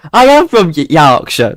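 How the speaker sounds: put-on loud, high, playful Yorkshire accent; exaggerated Yorkshire accent; hammed-up Yorkshire. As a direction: declare it in broad mock Yorkshire. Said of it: "it's literally in my accent".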